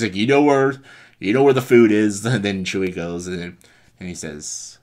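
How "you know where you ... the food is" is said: loud, raised-pitch banter; hearty joking. Retold or imitated. Luke telling Chewie about food, loud and jovial. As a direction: call it out jovially to a friend.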